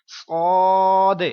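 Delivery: teacher shows صاد as a heavy letter; full heavy letter. Shown as a demonstration. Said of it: "پر پڑھوں گا".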